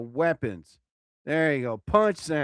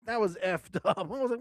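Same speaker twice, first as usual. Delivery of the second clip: usual speaking voice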